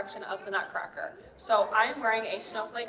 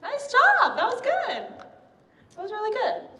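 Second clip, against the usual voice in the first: high, loud cheering praise; excited praise; 11 semitones higher, 7 dB louder than usual. Real, not acted. Praising children after their silent try.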